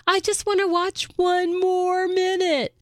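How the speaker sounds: put-on high, drawn-out kid whine; mock pleading little voice; pleading daughter. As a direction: imitate a child begging at bedtime, slow and high. Daughter pleading, imitated by mom.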